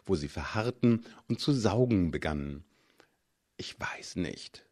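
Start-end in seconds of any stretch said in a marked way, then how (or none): 3.6-4.6 unvoiced, hesitant whisper, slow; hushed uncertain whisper. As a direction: breathe it out, unsure. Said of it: "flüsterte ich".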